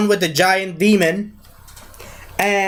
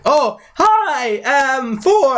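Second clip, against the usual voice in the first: high, awkward hello rising higher; mock nervous greeting; 7 semitones higher, much slower than usual. Loki caught out greeting Thor, mock nervousness. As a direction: greet him uneasily, pitched up.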